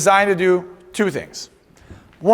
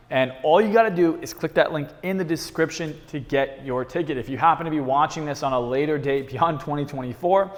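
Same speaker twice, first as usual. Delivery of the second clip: quieter, even ad read for the channel; promo read; 3 semitones lower, 5 dB quieter than usual. Acted. Host plugging his seminar to viewers, soft ad read. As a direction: rattle off the plug like an outro.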